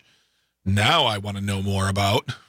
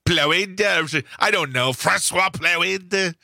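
higher-pitched, Chevalier-style French caricature; mock French accent; 6 semitones higher, much slower than usual. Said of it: "Chevalier type accent I am doing".

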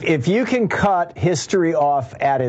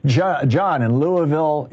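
ordinary talk

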